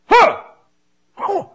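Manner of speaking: sudden high, loud "huh"; imitated involuntary yelp, short and high; imitated tic noise. Preacher imitating a Tourette's outburst on a plane, sudden yelps. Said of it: "give out a".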